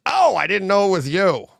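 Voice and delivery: a mild retold oops to his beaten friend; sheepish surprise. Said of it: "he goes".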